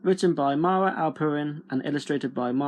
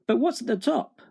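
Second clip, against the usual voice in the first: put-on higher, curious young Jack; wondering boy; 8 semitones higher than usual. A narrator's reading. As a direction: ask with eager wonder. Jack wonders about the beanstalk.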